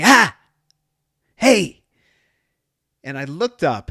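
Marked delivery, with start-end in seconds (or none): 0.0-1.8 put-on high, loud child's call; child waking dad. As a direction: shout it like a kid waking his dad. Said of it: "my son hitting me and going".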